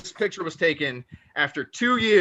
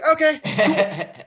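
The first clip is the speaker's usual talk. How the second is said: laughing; 11 semitones higher, 4 dB louder, much slower than usual. Retold or imitated. Mocking his own reply to mom.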